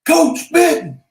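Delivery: loud high shout of a name; yelled out. Retold or imitated. Spotting old coach outside the store, loud high shout. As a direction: call out across the parking lot. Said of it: "And I yelled out".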